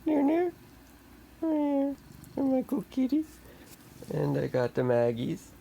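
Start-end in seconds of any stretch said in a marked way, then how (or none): 0.1-3.3 high pet talk. Real, not acted. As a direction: coo up high at the kitty. Baby talk to the cats.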